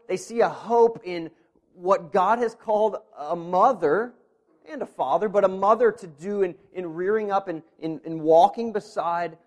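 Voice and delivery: loud, rising preacher's voice; impassioned preaching. Acted. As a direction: preach it with growing fervor. Preacher at full force.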